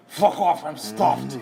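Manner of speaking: mock glutton. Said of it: "he goes".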